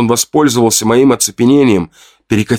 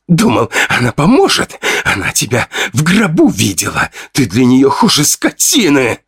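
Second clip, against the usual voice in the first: higher, breathless sneering jeer; cruel taunt; 4 semitones higher than usual. Acted. Fedya keeps sneering at the narrator about his mother. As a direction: wound him with words.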